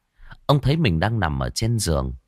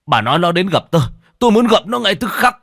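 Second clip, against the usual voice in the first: much higher and louder furious demand; angry shout; 10 semitones higher, 6 dB louder, faster than usual. A narrator's reading. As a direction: yell at his wife to bring him now. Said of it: "bực bội". (quát lớn giận dữ)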